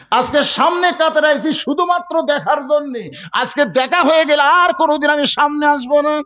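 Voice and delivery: high, urgent sermon delivery; impassioned preaching. Acted. Shepherd explaining why he came to the front row, impassioned. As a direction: voice the shepherd's vow at a preacher's pitch.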